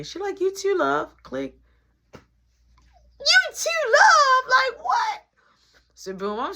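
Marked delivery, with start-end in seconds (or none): 0.4-1.1 put-on sweet older lady. Miss Shirley's sign-off quoted. 2.1-5.2 high, loud incredulous squeal; stunned disbelief. Retold or imitated. Disbelief at being called love.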